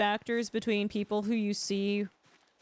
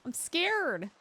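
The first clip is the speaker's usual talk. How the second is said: higher mock fear; mock scared; 7 semitones higher than usual. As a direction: cry it out in mock fright.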